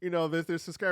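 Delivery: usual speaking voice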